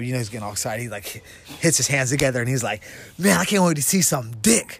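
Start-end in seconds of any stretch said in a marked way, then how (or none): 3.2-4.8 pumped-up quote. Retold or imitated. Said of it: "is all pumped up".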